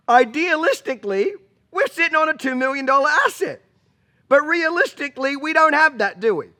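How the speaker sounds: laughing repeat. Real, not acted. Repeats punchline while laughing.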